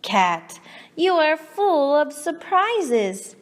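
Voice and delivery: usual speaking voice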